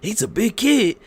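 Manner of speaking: high-pitched put-on amazement; mock teasing wrestler. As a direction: imitate a wrestler ribbing his rival. Retelling wrestler teasing opponent.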